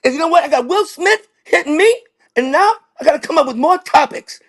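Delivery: very high, forceful stand-up voice; high-pitched comic impression, punching the phrases; Chris Rock. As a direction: squeal it out like a ranting comedian. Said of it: "And Chris Rock goes".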